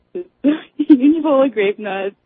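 ordinary talk

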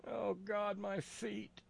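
high strained moan of pain; pained high groan. Acted. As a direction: whimper about your aching feet. Cartoon Jesus groaning in pain.

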